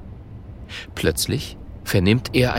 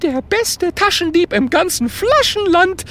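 very high, puffed-up ghost voice; proud boast. A narrator's reading. Ghost boasting of its pickpocket past, proud. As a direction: brag it with swelling pride. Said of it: "mit stolz geschwellter Gespensterbrust".

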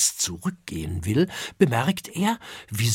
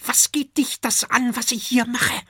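put-on high, snappy young dragon; young eating dragon snapping at the intruder; hissing back; 13 semitones higher, 4 dB louder than usual. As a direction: spit it out defiantly.

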